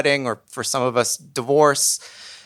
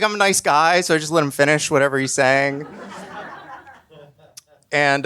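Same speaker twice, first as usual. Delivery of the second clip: normal talking voice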